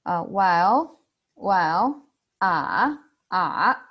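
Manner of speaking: "well" and "ah" dipping, then rising; a pondering "well" that sinks and lifts; third tone, thinking. Shown as a demonstration. Teacher models the thinking sound, dipping then rising. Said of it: "like a thinking sound when you are thinking".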